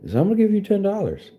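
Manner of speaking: high fast put-on voice for "ten dollars"; mock stingy donor. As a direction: talk down to him like a smug dealmaker. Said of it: "be like".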